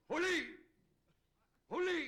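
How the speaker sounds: loud, high bullfighter cry of Ole; proud Ole yell. Retold or imitated. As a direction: belt it out like in the ring. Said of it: "he would yell".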